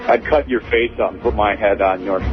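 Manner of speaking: ordinary talk, nothing marked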